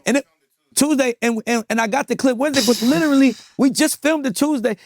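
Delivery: can't believe how fast it blew up; excited. Real, not acted. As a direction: voice climbing high with excitement. Excited retelling, rising voice.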